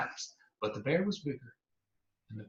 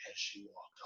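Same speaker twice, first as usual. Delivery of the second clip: usual speaking voice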